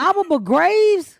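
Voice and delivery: slow, high, puzzled repeat; baffled. Real, not acted. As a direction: sound out the strange name, confused. Baffled repeat of strange name.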